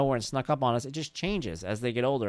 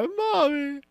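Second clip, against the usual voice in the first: put-on high, louder wailing toddler voice; mock toddler crying; 13 semitones higher, 5 dB louder than usual. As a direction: wail it like a two-year-old. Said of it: "I cry".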